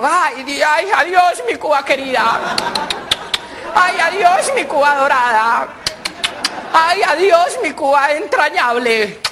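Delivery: high, slow wailing goodbye; dying farewell. Retold or imitated. Old man bids farewell to the tattooed flag, high wail. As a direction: sob out adiós to the flag.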